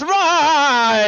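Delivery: high, drawn-out cry; flailing yell. Acted. One-legged man falls over, flailing yell. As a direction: cry out as you topple over. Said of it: "flails around, and he goes".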